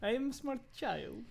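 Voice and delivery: soft, slow put-on orphan voice; orphan impression. Host echoing the orphan impression, soft and slow. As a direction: say it slowly and softly as the orphan. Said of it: "do an impression of the orphan".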